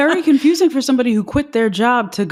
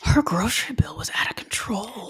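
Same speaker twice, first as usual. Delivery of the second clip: whisper; 7 dB quieter than usual. Real, not acted. Gossiping aside.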